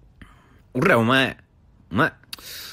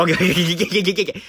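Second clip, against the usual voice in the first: laughing; 7 semitones higher, 4 dB louder, much faster than usual. Real, not acted. Repeating okay while laughing.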